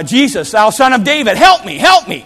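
high, loud desperate plea; acted beggar shouting for mercy; crying out for help. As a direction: cry out over a crowd to be heard. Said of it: "and he cried out".

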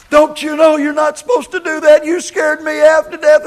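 high, loud shaken scolding; scared rant. Retold or imitated. Startled hunter scolding the preacher, high loud rant. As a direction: rant like you just got the fright of your life.

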